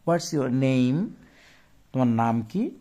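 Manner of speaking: ordinary delivery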